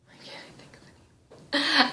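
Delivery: hushed, slow whisper while searching; whispered aside. Real, not acted. Trainer stuck for a name mid-demo, whispered aside. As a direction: whisper it to yourself.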